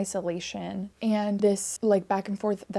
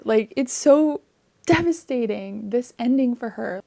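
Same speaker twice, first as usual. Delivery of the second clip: upset outburst; 4 semitones higher, 6 dB louder than usual. Real, not acted. Upset about the book's ending.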